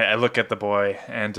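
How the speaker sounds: usual speaking voice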